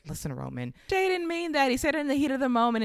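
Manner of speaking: mock nervous smoothing things over, fast; placating mediator. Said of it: "ever the mediator, says".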